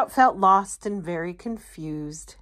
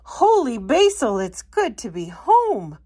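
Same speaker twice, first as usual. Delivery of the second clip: higher, louder joyful exclaim; bright relieved sprout; happy homecoming; 4 semitones higher, 4 dB louder than usual. Acted. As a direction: glad to be back. Sprout back home.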